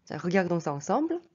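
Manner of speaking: normal talking voice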